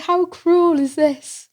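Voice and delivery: high, slow, incredulous squeal; retelling the cruel stairs, high and drawn out; mock outrage. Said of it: "I was like".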